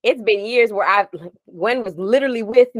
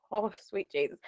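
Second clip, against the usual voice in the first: oh sweet Jesus breathed softly; quiet, awed oh sweet Jesus; hushed exclamation; 2 semitones lower, 14 dB quieter than usual. Retold or imitated. Quoting her reaction.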